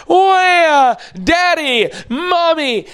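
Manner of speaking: put-on high, frightened little child calling out in the night; a child woken up scared, high and pleading; scared toddler. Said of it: "scared in the middle of the night".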